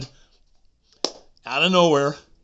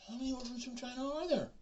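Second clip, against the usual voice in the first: mock high question; 4 dB quieter than usual.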